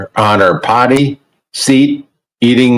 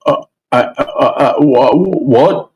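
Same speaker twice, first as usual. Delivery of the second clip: normal talking voice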